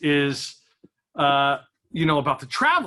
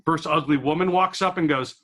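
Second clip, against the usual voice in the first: ordinary talk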